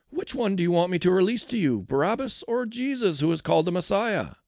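high, loud public question; calling to a crowd. A narrator's reading. Pilate asking the gathered crowds, calling out.

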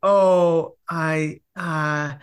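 imitating a reluctant stall; lost for words. Woman asked out on a date, acted as hesitant and lost for words. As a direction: trail off in mock hesitation, word by word. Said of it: "couldn't find the words".

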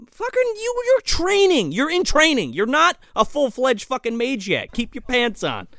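high-pitched incredulous telling-off, easing down; mock exasperated scolding. Mentor to a restless trainee mage, mock exasperation. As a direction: scold the impatient trainee in exaggerated disbelief.

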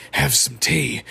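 creepy doll mocking the offer; Percy. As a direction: throw it back in a rough doll voice. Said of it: "He's like".

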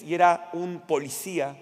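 usual speaking voice

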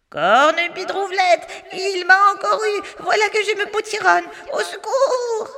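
high, strangled witch wail; a witch shrinking, crying for help; muffled wailing cry. Acted. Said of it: "gémit la sorcière dans un cri étouffé".

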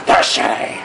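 husky, whispered Batman rasp; a breathy wolf-like growl. Retold or imitated. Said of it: "Batman's a little bit more husky".

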